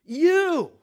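"You" high, loud and drawn out; emphatic YOU. Shown as a demonstration. Preacher showing the stressed word in the verse, "You" hit high and loud. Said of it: "emphatic is you".

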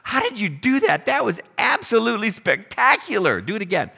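high, amazed outburst at the miracle; awed excitement. Pretend. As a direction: gush in wonder like a dazzled disciple. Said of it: "I think I would want to say".

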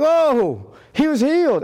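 high, bright, excited "Oh"; amazed crowd. Retold or imitated. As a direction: gush like an astonished onlooker.

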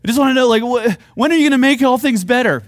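imitating impatient believers nagging God; whiny demand. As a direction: demand it like a fed-up kid. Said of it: "that's what it sounds like when we talk to him".

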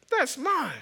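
put-on high, possessive little-kid voice; Kylie voice. Four-year-old seeing friend take her tube, imitated high whine. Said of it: "that's my Kylie voice".

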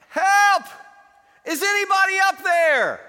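high, loud shout for help; a man dangling from a branch screams for help; desperate cry for help. Acted. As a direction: yell up the cliff, desperate. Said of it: "cries out".

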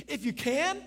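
high, loud incredulous question; incredulous echo. Acted. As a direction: throw the words back in disbelief, rising at the end. Jesus throws back father's words.

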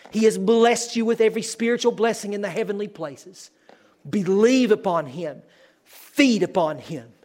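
loud, high-pitched preacher's proclamation; impassioned preaching. Real, not acted. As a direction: preach it at full force, ringing out. Preaches at full force.

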